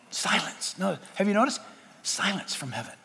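very soft, hushed aside. Acted. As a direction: drop right down as if listening to silence. Hushed imitation of heaven's silence.

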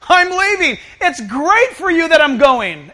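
loud, slowed proclamation; emphatic announcement. Acted. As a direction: break big news as if it were wonderful. Jesus repeats news to baffled disciples.

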